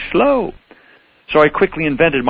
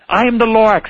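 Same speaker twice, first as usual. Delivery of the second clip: high, sniffly Lorax voice; coughing Lorax; 9 semitones higher, 3 dB louder than usual. Acted. Lorax back with more complaints, high and sniffly. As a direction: announce yourself with a cough. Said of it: "he coughed and he whiffed".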